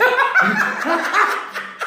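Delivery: high laugh at the punchline; high burst of laughter. Real, not acted. Caller laughing high at his own punchline. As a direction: crack up high after the joke.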